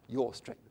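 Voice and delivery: low-pitched "your", set apart with weight; stress on YOUR. Shown as a demonstration. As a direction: lean on "your". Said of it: "the emphasis on the word your".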